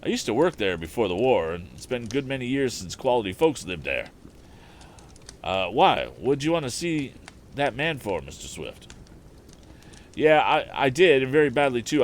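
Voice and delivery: a lightly voiced old Southern handyman; Eradicate's dialect voice. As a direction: play the old handyman, loud and eager. Eradicate voiced in read story.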